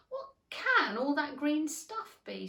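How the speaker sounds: lightly voiced wonder; wondering Alice. A narrator's reading. Alice staring down at green leaves, puzzled. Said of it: "said Alice".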